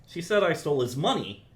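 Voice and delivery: "money" given the weight; stress on MONEY. Shown as a demonstration. Teacher shows stress to the composer, landing on "money". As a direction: finish leaning on "money". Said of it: "depending on how you emphasize".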